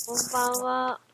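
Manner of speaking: stuffy nose from a cold; blocked-nose nasal greeting. Real, not acted. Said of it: "鼻声ですね。". (鼻声)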